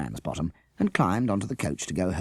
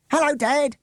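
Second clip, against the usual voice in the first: put-on high, loud child scream; excited scream; 7 dB louder than usual. A narrator's reading. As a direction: yell out to her dad in the crowd. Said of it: "Avril screamed".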